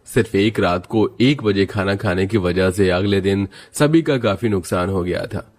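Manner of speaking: normal talking voice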